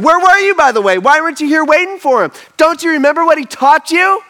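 high, loud, manic rush of questions; overexcited and insistent, building to exasperation; angel going crazy. Acted. Angel showing the empty tomb, going crazy with excitement. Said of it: "just kinda going crazy".

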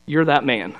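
low, composed pronouncement; quietly grave, imitating a calm prophet; calm confrontation. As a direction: state it calmly and let it land. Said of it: "just looks at him calmly".

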